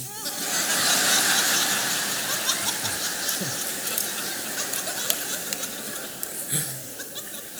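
high, breathy burst of laughter; hearty laugh. Real, not acted. Preacher laughing at his own story before the congregation, high breathy laugh. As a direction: laugh long at your own joke. Laughs after calling partner sissy.

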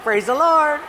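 high, loud church greeting; mock-pious praise, last word lifted; hammed-up praise. Father turning up at another church's potluck, hammed-up praise.

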